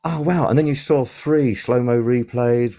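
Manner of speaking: imitating a pub fan, pitch jumping high on "wow"; a hammed-up gasp of wonder at the replay; mock amazement. Pub viewer reacting to replay.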